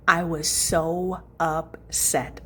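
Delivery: very slow, stretched-out words; slow, emphatic dismay; drawn-out dismay. Real, not acted. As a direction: drag out every word to stress the letdown. Recounting finding the rug gone.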